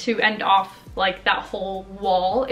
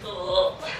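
normal talking voice

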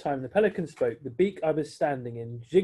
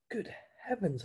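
soft, hushed gasp of surprise; whispered; 6 semitones higher, 7 dB quieter than usual. Acted. Billy whispers at the Duke's car, hushed. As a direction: hush it as the car arrives. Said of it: "I whispered".

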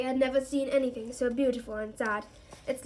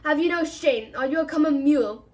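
loud, indignant centaur voice, higher; outraged centaur thundering at a friend; thundering rebuke; 4 semitones higher, 7 dB louder than usual. Acted. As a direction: scold him furiously for his shame. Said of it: "Bane thundered".

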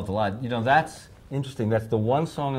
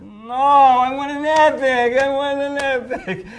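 stubborn insisting; 12 dB louder, much slower than usual. Retold or imitated. Speaker insisting on an epic.

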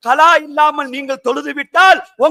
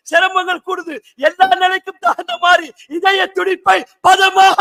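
rhythmic, raised list building in force; fervent preaching; 5 semitones higher than usual. Real, not acted. Preacher asking listeners about the heart's unceasing work, fervently. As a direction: press each phrase on the listeners.

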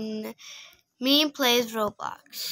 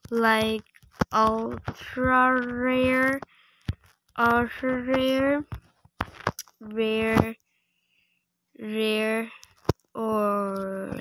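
slow words with long gaps between; slow dictation; 3 dB quieter, slower than usual. Real, not acted. Player typing to a trader about other pets, slow dictation. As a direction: drag out each word as you type it. Sounding out a typed message.

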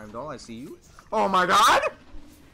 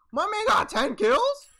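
incredulous; 8 dB louder than usual. Real, not acted. Amazed at enemy's score.

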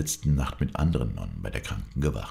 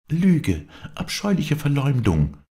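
high, loud, furious denial; vehement; 13 semitones higher, 6 dB louder, slower than usual. A narrator's reading. Viola rejects the slander against Maria, vehemently. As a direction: throw it back loudly at a high pitch. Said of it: "entgegnete Viola heftig".

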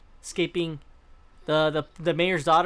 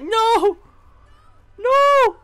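mock anguished scream, much higher and louder; imitating her scream; 12 dB louder than usual. Streamer reenacting Amicia's cry, hammed-up scream. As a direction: shout it like the game heroine losing it. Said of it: "I was like".

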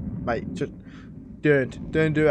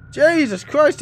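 startled shout; 11 semitones higher, 6 dB louder, slower than usual. Real, not acted. Finds parents torn apart.

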